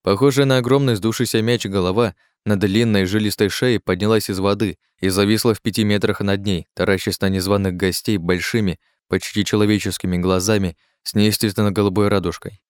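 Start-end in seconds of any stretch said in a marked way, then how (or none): none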